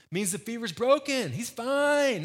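high-pitched, overeager optimism; eager persuasion. Acted. Disciples convincing Jesus that Lazarus needs no visit, hammed-up eagerness. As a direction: sell the good news with pitch soaring.

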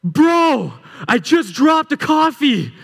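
a hint of a sheepish climbing partner; sheepish buddy confession. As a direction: admit it like a guilty friend, drawn out. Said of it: "And he goes".

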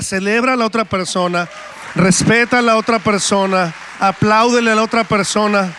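loud, driving preacher exhortation; preaching at full force. Acted. Pastor urges the congregation to applaud others, loud preaching. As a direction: call the church to celebrate others, full voice.

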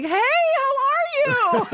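high, slow, sing-song cheerful hello; mock friendly greeting. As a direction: kill his anger with a sugary hello. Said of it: "and I was like".

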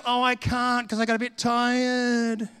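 slow, drawn-out mock complaint; whining excuse. Preacher mimicking Christians who find it hard, whiny. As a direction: mimic a feeble, reluctant believer. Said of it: "you get Christians who go".

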